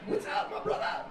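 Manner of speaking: very high screechy rock-star greeting; wild, high-energy imitation of a singer. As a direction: squeal a manic frontman's hello. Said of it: "Homeboy walks around all the time, he's like".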